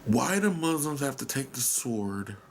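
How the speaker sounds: normal talking voice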